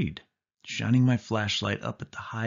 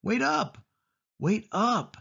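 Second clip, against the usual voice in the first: higher, anxious call after her; 12 semitones higher, slower than usual. A narrator's reading. The boy calls to Sari ahead in the tunnel, anxious. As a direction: call after her as she pulls away.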